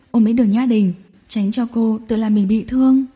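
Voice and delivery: plain reading voice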